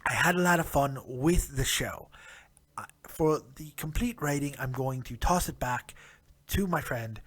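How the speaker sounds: put-on British accent for the whole recap; a jokey British accent, tea and crumpets style. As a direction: play a proper British bloke reviewing wrestling. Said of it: "Thank you, British version of me".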